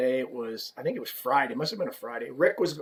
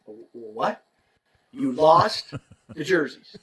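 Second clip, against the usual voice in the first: stunned; 3 dB louder, slower than usual. Retold or imitated. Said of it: "I was stunned".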